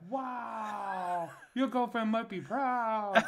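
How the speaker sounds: high, slow, laughing mimicry of the masseuse; amused masseuse imitation. Retelling the masseuse teasing him, high laughing mimicry. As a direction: play her teasing line while cracking up.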